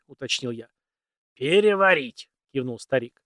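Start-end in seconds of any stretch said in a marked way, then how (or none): 1.4-2.3 put-on very old man.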